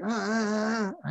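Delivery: high, strained ah sounds from a patient who can't speak; imitated panicked grunts. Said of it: "and he was going".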